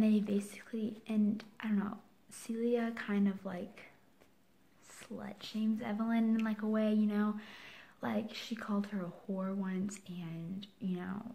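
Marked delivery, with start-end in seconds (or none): 1.1-3.4 breathy hushed voice; whisper. Real, not acted. 8.0-10.7 voiceless whisper. Real, not acted.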